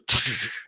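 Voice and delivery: short hissy rattling noise; imitated ladder rattle. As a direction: make the sound of a shaking ladder. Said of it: "the ladder goes".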